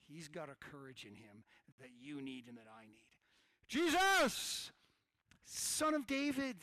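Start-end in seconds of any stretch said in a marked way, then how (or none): 3.7-6.6 loud, high, drawn-out call to Jesus; crying out. Acted. Blind beggar to passing Jesus, crying out. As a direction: call out desperately over a crowd. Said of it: "he just starts yelling louder".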